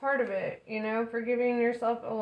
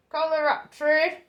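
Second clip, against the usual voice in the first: higher, louder accented Kalaratri; put-on accent; 7 semitones higher, 7 dB louder than usual. Shown as a demonstration. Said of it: "I have to say it with an accent".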